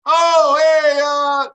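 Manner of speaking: a loud, high yelp of surprise; sudden shout. Real, not acted. Fan yelling at a sudden play on the TV, loud. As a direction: blurt it out loud at the screen. Sudden reaction while watching game.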